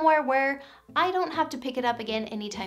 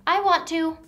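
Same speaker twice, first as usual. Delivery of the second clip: put-on eager toddler; 5 semitones higher, 6 dB louder than usual. Toddler voice in parent demo.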